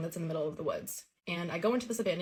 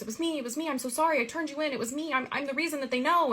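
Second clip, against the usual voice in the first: much higher, pleading retold confession; imitated tearful confession; 8 semitones higher than usual. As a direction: act out an upset child confessing, without real sobs. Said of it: "she starts crying and she goes".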